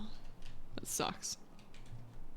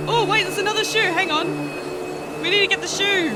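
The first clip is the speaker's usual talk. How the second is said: excited; 10 semitones higher, 6 dB louder, faster than usual. Real, not acted. Spots a shoe in game.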